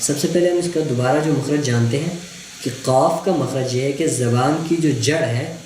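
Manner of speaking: ordinary talk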